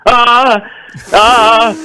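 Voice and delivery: high strangled "ah, ah" sounds; nervous high whimpers. Retold or imitated. Starstruck comic meeting [name], making a nervous high whimper. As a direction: whimper high like you're losing it. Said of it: "suddenly started to make this voice".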